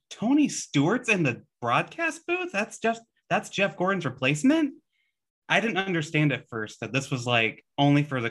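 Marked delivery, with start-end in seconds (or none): 0.1-4.7 high, incredulous questioning; puzzled, raised-pitch mock astonishment; retold disbelief. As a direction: re-enact being baffled by the news. Acting out own baffled reaction.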